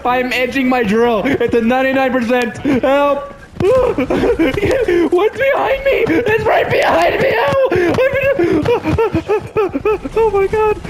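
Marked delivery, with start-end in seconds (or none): 2.8-10.8 high panicked laughing shouts; laugh-scream as it chases you; scared horror game streamer. Real, not acted.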